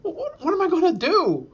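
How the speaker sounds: high, desperate plea; high-pitched panicky complaint; panicked whine. Retold or imitated. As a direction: beg for help, near panic. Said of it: "And I said".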